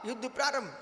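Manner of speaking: hushed, very soft war announcement; quiet announcement. Acted. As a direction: announce the battle under your breath. Same announcement softly, then loudly.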